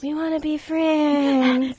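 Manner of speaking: sing-song like an eerie stalker; slow, high, mock-creepy plea; creepy friendly. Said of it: "Let's be really creepy about this".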